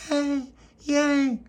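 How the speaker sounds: put-on very high loud toddler cheer; baby cheering. Baby Lucia cheering for jam butty, very high squeal. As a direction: squeal yay like a delighted baby.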